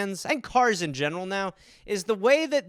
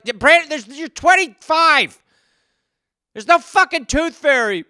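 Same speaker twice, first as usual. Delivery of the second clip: exasperated parent; 8 semitones higher, 6 dB louder than usual. Acted. Parent tells grown son the truth, exasperated. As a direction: spell it out, fed up.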